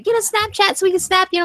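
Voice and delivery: high-pitched, pestering fan impression; eager fans. Voicing pleading fans.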